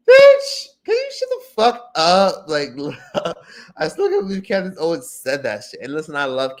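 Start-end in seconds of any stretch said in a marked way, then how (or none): 0.1-2.4 high, loud screech of outrage; outraged retort. Acted. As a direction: yell it way up high, exasperated. Exasperated retort to Candace's quoted remark.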